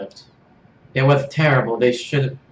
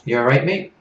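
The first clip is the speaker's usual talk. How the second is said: put-on a shaky go at an Australian accent, higher; Australian accent, badly done; attempted Australian accent; 4 semitones higher, 4 dB louder than usual. [name] greeting Serge in a shaky Australian accent. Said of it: "I thought you were supposed to be Australian".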